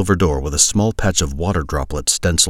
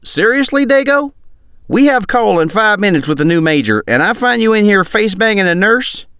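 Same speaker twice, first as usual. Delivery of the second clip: high, loud exasperated soldier voice; scolding soldier; 6 dB louder than usual. Acted. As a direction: chew out a buddy in disbelief.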